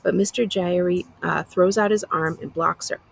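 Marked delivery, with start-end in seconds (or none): none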